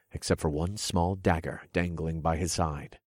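usual speaking voice

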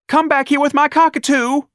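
very high, loud desperate call; cried. Acted. Darlene calling after Ignatius for her cockatoo, very high and loud. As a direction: shout after him for the bird. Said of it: "Darlene cried".

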